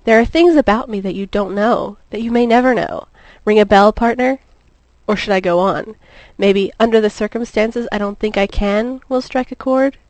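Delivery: usual speaking voice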